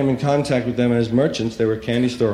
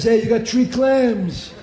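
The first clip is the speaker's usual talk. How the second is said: street tough voice, a bit higher; Italian street guy; 9 semitones higher than usual. Retold or imitated. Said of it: "Money is bananas".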